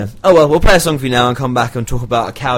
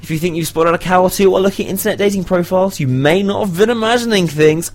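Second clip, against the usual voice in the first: news read; 9 semitones higher, 4 dB louder than usual. Acted. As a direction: announce the story like a newsreader. Reading a news item.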